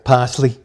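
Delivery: Parsley said the New England way, with no r sound; New England pronunciation. Shown as a demonstration. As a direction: give Parsley a New England accent, dropping the r. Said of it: "That's how they say it in New England".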